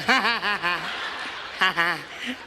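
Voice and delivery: put-on rising chuckle; a sly little laugh. President chuckling after the joke.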